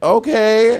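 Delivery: high, skeptical okay; a wary parent's okay; doubtful okay. Retold or imitated. Parents accepting the cat news, high and doubtful. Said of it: "And they were like".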